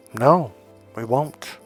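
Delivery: normal talking voice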